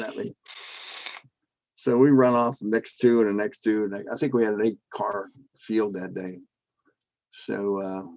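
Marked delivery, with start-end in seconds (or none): none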